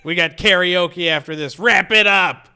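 loud call over a bar; impatient heckle. Acted. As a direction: shout it out, building to a demand. Calls out as impatient bar host.

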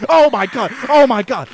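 loud, high panicked oh my God; loud fearful outburst. Real, not acted. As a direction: yelp while fleeing a monster. Chased and frightened by squids.

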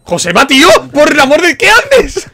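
loud, very high exasperated yell; exasperated shout. Real, not acted. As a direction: yell in disbelief at a teammate. Teammate blunders at spike.

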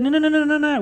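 eager no. Retold or imitated. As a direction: jump in high and keen to wave it away. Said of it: "They said".

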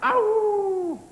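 very high, sustained wolf howl; wolf impersonation pitched right up; big wolf howl. Pretend. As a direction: let out a long, soaring howl like a big wolf. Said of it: "I mean a big wolf".

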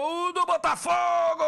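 high ringing goal call; goal shout. Real, not acted.

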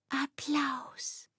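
soft, airy, high whisper; breathed whisper. A narrator's reading. Denise imagining the audience at curtain-up, breathing it. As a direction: breathe the word out in awe. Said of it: "hauchte Denise".

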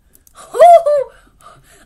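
loud high burst of laughter; joyful laugh. Real, not acted. Finding friend in game.

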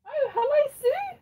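very high, loud singsong hello; real excited greeting. Real, not acted. Streamer greeting Sue as she joins, excited high singsong. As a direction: sing out a delighted high-pitched welcome.

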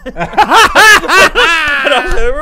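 high loud laughter through words; can't hold the laugh in; laughing hard. Real, not acted. Telling friend the bar story, laughing loudly.

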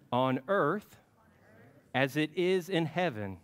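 put-on slow, paced phrases for the children to repeat; prayer recitation. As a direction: lead the prayer line by line, slowly. Leads the Lord's Prayer.

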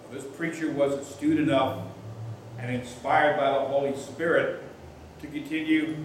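ordinary talk, nothing marked

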